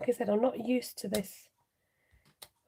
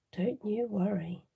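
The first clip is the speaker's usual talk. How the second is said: ordinary delivery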